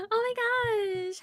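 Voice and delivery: ordinary delivery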